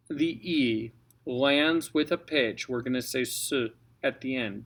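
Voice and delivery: normal talking voice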